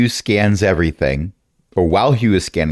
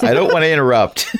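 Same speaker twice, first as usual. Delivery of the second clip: laughing; faster than usual. Real, not acted. Laughing while speaking.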